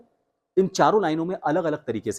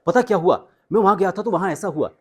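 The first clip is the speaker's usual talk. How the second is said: higher, louder excited telling; excited demo; 7 semitones higher, 5 dB louder than usual. Shown as a demonstration. Voice coach shows how excitement sounds: higher and louder. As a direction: blurt out news with a high, bright rush. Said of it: "थोड़ा सा fast हुआ और थोड़ा सा उसका pitch high हुआ".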